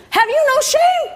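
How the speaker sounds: very high, loud scandalized scold; hiss-scold her in public, mortified; mortified daughter scolding mother in the lot; shrill scold. Retold or imitated. Repeated scolding.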